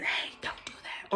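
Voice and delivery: soft unvoiced whisper; small inner voice. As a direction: whisper it like a quiet conscience. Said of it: "feelings is that small voice".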